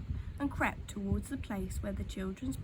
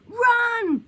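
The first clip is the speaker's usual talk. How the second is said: high, very loud warning; monkey yells at the children to run; urgent shout; 12 semitones higher, 13 dB louder than usual. A narrator's reading. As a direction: bellow it to make them flee. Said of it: "Mugglewump shouted to the children".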